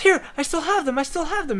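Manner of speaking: high, eager and breathless; eager bartender. Retold or imitated. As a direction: proudly show off the coins. Bartender eagerly shows saved coins.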